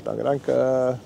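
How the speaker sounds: ordinary talk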